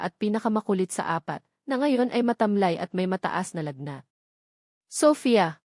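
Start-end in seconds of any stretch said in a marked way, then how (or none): 4.9-5.6 calling her daughter's name, a touch raised; urgent but understated call; mother calls out to her sick child; mild cry out. Acted. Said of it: "sigaw niya".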